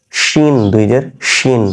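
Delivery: "শিন" with a full sh; palatal sh. Shown as a demonstration. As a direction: slowly say both "শিন" with a palatal sh. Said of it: "এটা তালব্য স দিয়ে".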